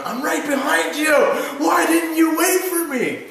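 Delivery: high, strained mock shout; imitated angry caller. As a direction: yell it like an irate worker left behind. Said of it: "the guy's going".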